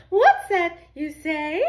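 high, loud and slow, every word drawn out; slow high sing-song call. Shown as a demonstration. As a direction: stretch it out like a playful echo for the kids to copy. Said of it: "I said".

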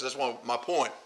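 ordinary talk

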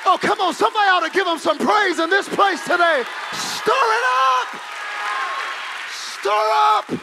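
loud, high preaching, nearly shouting; fiery preacher stirring the congregation; preaching at full force. Acted. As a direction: whip the church up with shouted calls.

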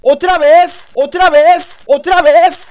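screaming high in fury; raging gamer. Real, not acted. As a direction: yell it over and over, furious. Raging at teammates, repeated yells.

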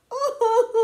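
high little cub's fake sobbing; high, whimpering pretend cry; acted baby boo-hoo; mock wail. A narrator's reading. Baby Bear wails over soup.

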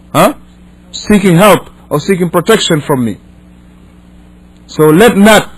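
normal talking voice

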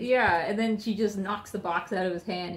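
usual speaking voice

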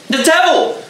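shrill high startled crone cry; old lady's cry. Acted. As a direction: shriek at the serpent touch. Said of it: "cried the pious old lady".

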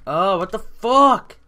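loud, high, drawn-out angry yell; angry outburst. Real, not acted. Gamer cursing another failed jump, angry yell. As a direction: yell it, stretching the swear word. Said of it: "I'm so angry".

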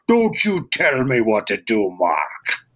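puffed-up cartoon voice, dropping low on Mark; a showy cartoon read; big blustery character voice. Shown as a demonstration. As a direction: play the frosting, all colour and size. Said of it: "So he's this big".

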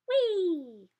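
high gleeful wee; surfing wee. Acted. Reading to kids, surfing dolphin with a high wee. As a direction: squeal like riding a wave. Dolphins surf, sound effect.

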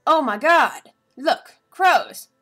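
high, loud hammed-up cry; mock heroics. Alice blasting the crows, high and hammed up. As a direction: play the hero big. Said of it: "is like".

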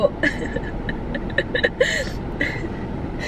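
high unvoiced laugh through the nose; breathy laugh. Real, not acted. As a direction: laugh softly, half to yourself. Mom laughs at the boy's complaint.

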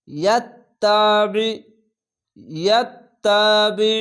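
ইয়াত্তাবি read slowly, throat tightened on the final ayn; thick squeezed ayn. Shown as a demonstration. Teacher modelling ayn, ইয়াত্তাবি with a thick throaty ending. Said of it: "আইনটিকে মোটা করে গলা চিপে".

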